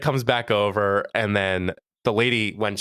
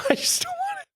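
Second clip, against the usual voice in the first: laughing repeat; faster than usual. Real, not acted. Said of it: "repeating friend's line laughing".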